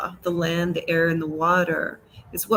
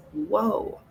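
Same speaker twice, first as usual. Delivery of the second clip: amazed; 8 semitones higher than usual. Real, not acted. Awe at greater works.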